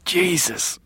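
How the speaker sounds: hoarse, strained gasp. Acted. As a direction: croak it out in pain. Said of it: "he said hoarsely".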